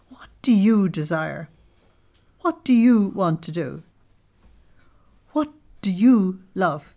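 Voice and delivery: each what pressed hard, the first one whispered; emphatic reading. Shown as a demonstration. Reading Jesus' questions to the group, with heavy emphasis. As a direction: lean on every what, building from a hush. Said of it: "Notice the emphasis I've given to you".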